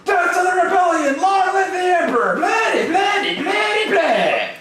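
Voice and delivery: high-pitched fanatical slogan shouting; screaming propaganda. Acted. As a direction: scream the slogans in a shrill voice, dropping on the last word. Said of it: "rolling around screaming".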